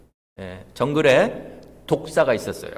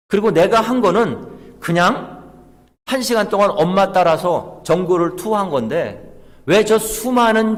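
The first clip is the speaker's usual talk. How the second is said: higher-pitched mock protest of a baby snake; puzzled baby snake; 6 semitones higher, 4 dB louder than usual. Baby snake asking mother why the animals curse them, puzzled protest. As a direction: voice the little snake's aggrieved question, higher and insistent. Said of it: "아기 독사가 엄마 독사한테 이렇게 묻습니다".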